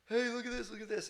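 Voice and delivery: high, eager call; proud kid holding up a turtle; high-pitched look-at-this call; excited show-off. Retold or imitated. Cousin shows off caught turtle.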